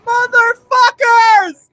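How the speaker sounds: high, loud, frantic yelling; screaming excitement. Real, not acted. Fan yelling at every trailer shot in wild excitement. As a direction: lose it completely. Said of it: "blew out your ears in excitement".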